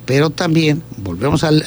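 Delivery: normal talking voice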